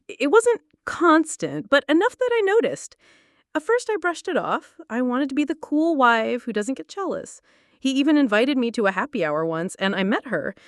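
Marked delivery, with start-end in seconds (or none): none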